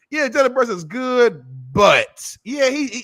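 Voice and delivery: high, drawn-out hedging mimicry; grudging praise with a but. Mimicking barbershop fans who qualify Brunson, drawn-out grudging praise. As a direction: stretch it out high, imitating reluctant doubters. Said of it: "people always add a but".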